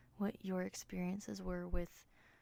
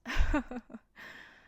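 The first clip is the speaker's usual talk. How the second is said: breathy laugh; 5 semitones higher, 9 dB louder than usual. Real, not acted. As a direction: let out a breathy chuckle. Laughs after asking for grace.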